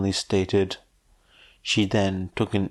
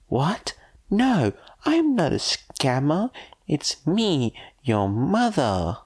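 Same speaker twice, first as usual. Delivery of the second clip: high, offended woman's voice; insulted woman; 8 semitones higher, slower than usual. Acted. The woman protesting she's no scammer, high and offended.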